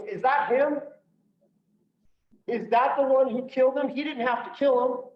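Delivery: upset victim. Retold or imitated. Hostage victim accusing the officer as he walks by, high and distressed. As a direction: voice her horror, pitched well up.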